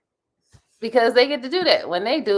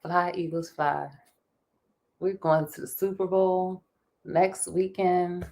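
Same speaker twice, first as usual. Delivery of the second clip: usual speaking voice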